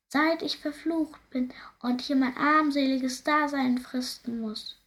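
high, sighing voice of a cursed ghost; mournful ghost. Acted. Ghost lamenting his curse to the princess, high and mournful.

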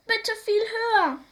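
put-on high, pushy child's plea; "viel" stressed high in an eager plea; eager pleading. A narrator's reading. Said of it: "sagt Bruno".

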